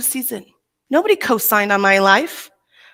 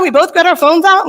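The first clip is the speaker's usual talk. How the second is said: ordinary talk, nothing marked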